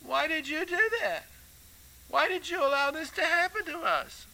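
high-pitched whiny accusation; mock aggrieved pleading; blaming whine. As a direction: whine blame up at God. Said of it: "He just blames the Lord".

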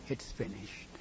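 low, soft, hushed telling. Acted. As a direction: pass it on softly like a secret. Grass passes on news.

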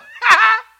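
loud, high derisive cackle; mocking laugh. Shown as a demonstration. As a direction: burst out in huge pointing-and-laughing guffaws. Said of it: "point and go".

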